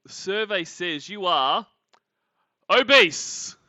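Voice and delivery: slow build to a loud, high punch; mock game-show reveal. Host revealing BMI calculator result to Lorne, mock game-show announcer. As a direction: hold back, then belt out the verdict.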